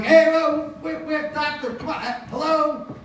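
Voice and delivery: high-pitched, frantic calling out; urgent hey, then high pleading hellos; calling for help. Acted. Calling out for missing doctor.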